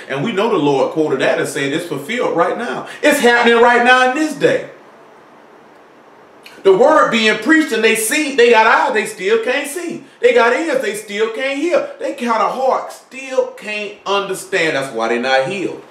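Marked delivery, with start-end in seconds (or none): 3.0-14.7 preaching at full force. Acted. Preaching emphatic build.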